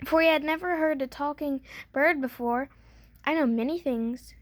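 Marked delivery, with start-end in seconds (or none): none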